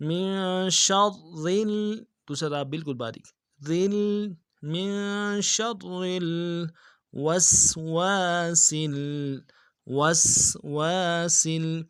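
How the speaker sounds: slow melodic tajweed recitation; Quran recitation. Shown as a demonstration. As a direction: chant it slowly with full tajweed. (قرآن کی تلاوت، آہستہ اور سریلی)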